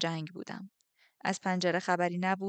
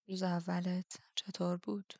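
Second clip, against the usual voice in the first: slow, whispered question; slow intimate whisper; soft whisper; 6 dB quieter, slower than usual. Acted. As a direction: draw her in with a hushed question. The Darkling asks softly.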